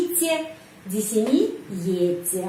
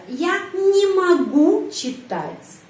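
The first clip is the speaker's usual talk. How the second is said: slow, louder, stress on не; slow model sentence; 7 semitones higher, 3 dB louder, much slower than usual. Shown as a demonstration. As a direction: read each word out slowly for the class.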